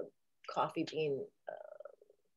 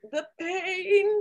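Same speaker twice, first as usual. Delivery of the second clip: "pain" pitched high and loud; mock agony; 12 semitones higher, 6 dB louder than usual. A high, loud wail on "pain" as Doctor Smith. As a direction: squeal "the pain" like a camp coward. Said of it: "like Doctor Smith".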